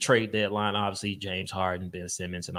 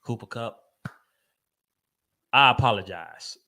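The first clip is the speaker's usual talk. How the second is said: normal talking voice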